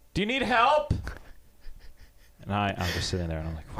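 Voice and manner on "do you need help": high, loud mock holler; imitated yell. Lady outside to old man, imitated yelling. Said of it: "A lady yells".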